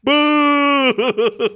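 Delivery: loud high long boo; crowd booing. Retold or imitated. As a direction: boo her like the whole kitchen. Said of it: "cooks are like".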